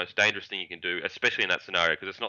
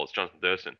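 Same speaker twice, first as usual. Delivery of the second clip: ordinary talk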